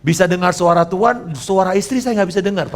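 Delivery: ordinary talk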